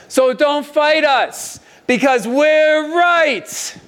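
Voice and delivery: slow, high drawn-out yell across; mock yell. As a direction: yell it over, smug and slow. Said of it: "he yells this over to the other side".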